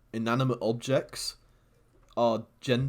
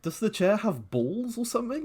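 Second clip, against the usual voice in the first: incredulous; 11 semitones higher than usual. Real, not acted. Mocking incredulous question.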